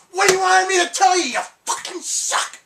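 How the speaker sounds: loud, high exasperated outburst, last words slowed; retold angry snap. Telling off the girl who kept asking how she sang, loud and fed up. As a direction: let all the annoyance out at once.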